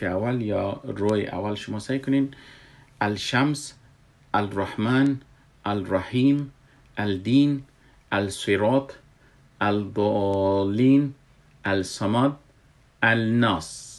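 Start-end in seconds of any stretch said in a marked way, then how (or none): 3.0-13.9 الشمس as "al-shams", L sounded before the sun letter; spelling pronunciation of words like الدین, keeping every written L; pronounced as written. Shown as a demonstration. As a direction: read الرحمن and الناس letter-true, "al-rahman", "al-nas", without merging the L. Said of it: "این تلفظی که ما کردم".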